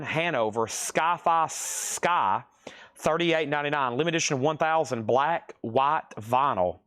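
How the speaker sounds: ordinary talk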